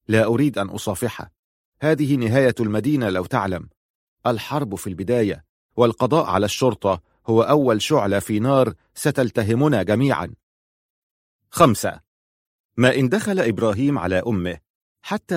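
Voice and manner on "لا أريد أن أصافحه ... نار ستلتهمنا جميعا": ordinary delivery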